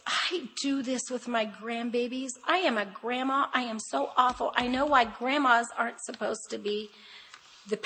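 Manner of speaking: ordinary talk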